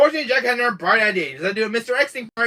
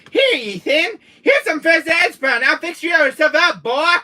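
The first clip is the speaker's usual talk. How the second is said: higher, louder mock Jack Baker voice; Jack's voice; 11 semitones higher, 7 dB louder than usual. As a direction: play the taunting villain, loud and high. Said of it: "He was like".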